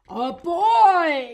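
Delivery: high, loud, drawn-out holler; bear's yell. A narrator's reading. Bear spots the boy Eddie, yelling. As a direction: yell it out in storybook alarm. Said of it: "yelled the bear".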